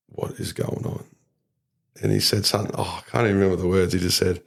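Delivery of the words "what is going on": retold inner thought, almost whispered; hushed disbelief. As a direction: breathe it quietly, as if thinking in bewilderment. Silent dread in manager's office.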